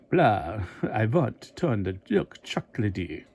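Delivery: slow mock vampire voice with a laugh; voicing the Count from the cereal box as a mock vampire; Count Chocula impression. Lightly hammed-up vampire. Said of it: "the Count".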